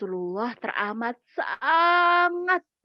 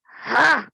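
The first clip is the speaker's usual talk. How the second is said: loud exhale; 7 dB louder than usual. Acted.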